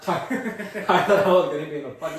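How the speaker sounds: loud, laughing recollection. Real, not acted. Speaker tells co-host about falling downstairs, really laughing. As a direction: laugh through your own mishap. Laughs telling of his fall.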